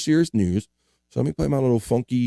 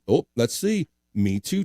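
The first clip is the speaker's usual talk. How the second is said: usual speaking voice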